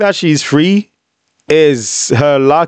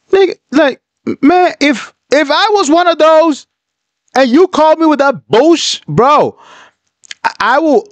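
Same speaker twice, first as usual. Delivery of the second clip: outraged outburst; 12 semitones higher than usual. Real, not acted. Outraged at her call.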